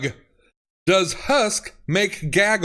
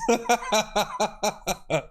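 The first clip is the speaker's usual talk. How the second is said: bursting into laughter; helpless laugh; 3 semitones higher, 4 dB quieter than usual. Real, not acted. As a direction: crack up helplessly. Cracking up.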